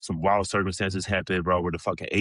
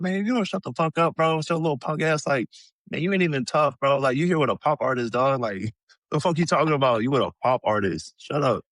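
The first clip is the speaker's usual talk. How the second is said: retold clapback; 7 semitones higher than usual. Snapping back at the heckler.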